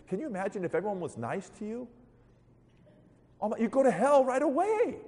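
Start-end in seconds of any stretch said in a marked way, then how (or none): 3.4-5.0 high-pitched comic exclamation; mock alarm. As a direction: exclaim in hammed-up dismay. Exclaims at imagined easy life.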